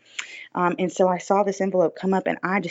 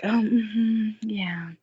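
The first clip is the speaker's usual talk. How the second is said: pitying whisper; 4 semitones higher, much slower than usual. Retold or imitated. Parents' pitying reaction voiced.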